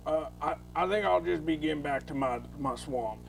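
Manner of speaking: put-on Cajun accent. Cajun Willie backing away.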